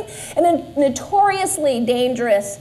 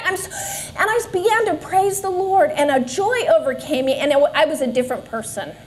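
ordinary talk

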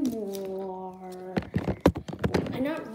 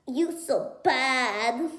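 mocking TikToker; 4 semitones higher, 4 dB louder, much slower than usual. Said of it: "If all of you TikTokers say".

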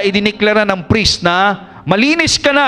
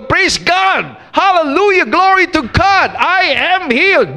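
raised, joyful praise of the healed leper; shouting praise; 8 semitones higher, slower than usual. Retold or imitated. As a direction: call out thanks to God, high and glad. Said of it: "Pero alam mo ganito". (masayang sigaw ng papuri)

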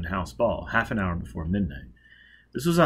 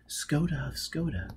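quiet aside; 5 semitones higher, 7 dB quieter than usual. Acted. Hushed aside introduction.

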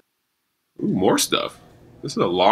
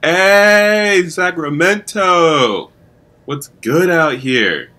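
higher, louder, slow hype shouting; excited hype; 8 semitones higher, 8 dB louder, much slower than usual. Real, not acted. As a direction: cheer like you just arrived somewhere great. Celebrating arrival in Sacramento.

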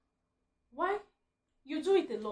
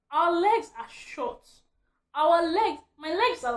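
emphatic; 6 semitones higher, 11 dB louder, slower than usual. Real, not acted. Ranting about short legs.

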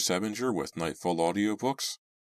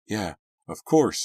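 usual speaking voice

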